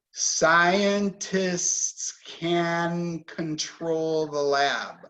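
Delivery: words of the sentence drawn out and linked together; slow connected speech. Shown as a demonstration. Teacher models connecting the words, slow and linked. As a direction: stretch each sound slowly into the next. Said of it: "So when we connect this, we say".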